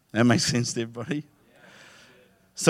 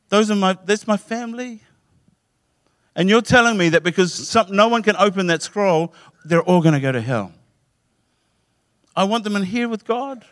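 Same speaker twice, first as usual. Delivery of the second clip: much higher, pleading and pressing on; desperate pleading; 8 semitones higher than usual. Retold or imitated. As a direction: beg like a man about to lose his family. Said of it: "John is getting desperate".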